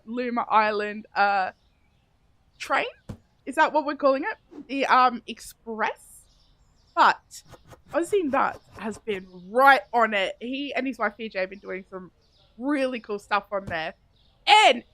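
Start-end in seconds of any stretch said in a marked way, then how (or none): none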